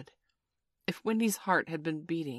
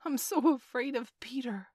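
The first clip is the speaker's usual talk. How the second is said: high, frightened confession; shaking; 9 semitones higher than usual. A narrator's reading. Toodles dreading Peter's return, fearful. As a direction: pitch it high and full of dread.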